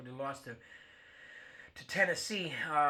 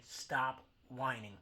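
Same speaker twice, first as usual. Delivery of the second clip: slow, drawn-out scolding; slow stop whining; 3 semitones lower, 4 dB quieter, much slower than usual. Acted. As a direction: spell it out slowly, word by word. Rant at Kansas City fans.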